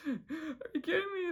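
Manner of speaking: high, cracking disbelief; half-laughing outrage at Hop Pop lending his wallet; incredulous. Real, not acted. Vlogger outraged that Hop Pop hands over his wallet, incredulous.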